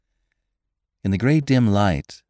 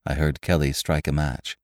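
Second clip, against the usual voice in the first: ordinary delivery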